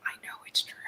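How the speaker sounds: a hushed, breathy aside, almost no voice; whisper. Retold or imitated. Guest whispering what she knows is true. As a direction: whisper it like a guilty secret. Mock self-quote after off-color statements.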